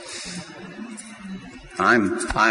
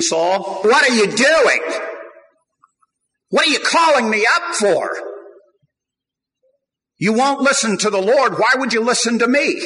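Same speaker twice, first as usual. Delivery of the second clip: much higher, louder rebuke to Saul; upset prophet Samuel; 13 semitones higher, 4 dB louder than usual. Retold or imitated. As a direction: scold like an annoyed prophet. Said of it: "Samuel the prophet was a little upset".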